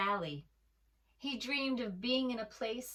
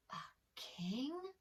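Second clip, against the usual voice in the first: a breathless, wondering whisper; hushed whisper; 5 semitones higher, 6 dB quieter than usual. A narrator's reading. Lamb hearing of the new king, awed whisper. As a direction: whisper it in awe.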